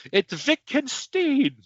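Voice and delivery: very high, drawn-out Wittgenstein; hammed-up pronunciation. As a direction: squeak out Wittgenstein slowly, ending in "steen". Hams up the uncertain name.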